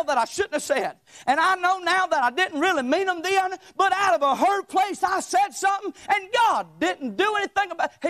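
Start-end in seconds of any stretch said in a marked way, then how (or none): 1.8-8.1 higher, shouted testimony; preaching shout. Real, not acted. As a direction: raise it to a full pulpit shout. Preacher builds to full force.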